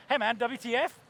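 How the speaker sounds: laid-back, friendly WTF; mild, casual texting voice; casual query. Shown as a demonstration. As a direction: toss off the letters lightly, like greeting a pal. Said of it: "Are you going for, like".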